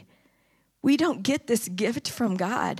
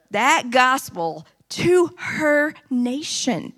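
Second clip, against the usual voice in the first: louder, slowing down and pressing each word; full-force preaching; 7 dB louder than usual. Acted. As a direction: preach it, building to the climax.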